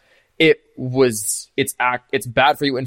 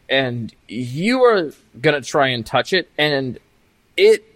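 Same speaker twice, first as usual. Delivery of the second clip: ordinary talk, nothing marked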